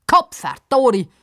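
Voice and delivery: loud grumbling curse; scolding. A narrator's reading. Old man scolds again, loud curse. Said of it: "fängt er wieder an zu schimpfen".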